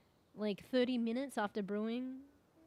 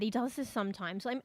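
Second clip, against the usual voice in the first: ordinary talk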